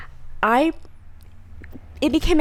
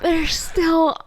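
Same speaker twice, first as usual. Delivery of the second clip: exasperated self; 7 semitones higher, much slower than usual. Retold or imitated. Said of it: "I come back and I'm like".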